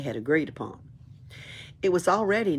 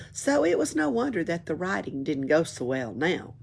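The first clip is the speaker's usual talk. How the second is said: ordinary delivery, nothing marked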